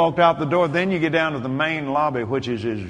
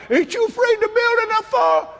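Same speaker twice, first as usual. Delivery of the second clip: fearful bystander; 5 dB louder than usual. Retold or imitated. Onlookers' fearful question quoted.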